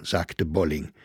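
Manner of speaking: usual speaking voice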